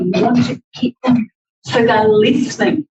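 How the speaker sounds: usual speaking voice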